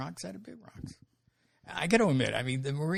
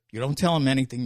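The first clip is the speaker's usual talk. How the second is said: louder, sharp order; stern warning; 10 dB louder, faster than usual. Retold or imitated. Drill instructor warning the recruit, stern and louder. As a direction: lay down the law to the recruit.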